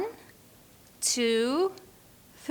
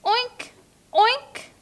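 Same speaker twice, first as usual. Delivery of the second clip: high, squeaky oink; high cartoon pig snort; 10 semitones higher than usual. Shown as a demonstration. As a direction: oink like a little piglet. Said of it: "little pink pig".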